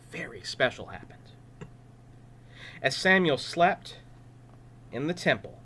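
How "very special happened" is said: breathy hush of wonder; storyteller's whispered awe. A narrator's reading. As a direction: whisper it like a secret.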